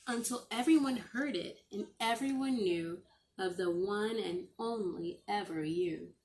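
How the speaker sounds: ordinary delivery, nothing marked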